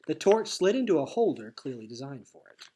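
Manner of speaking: ordinary delivery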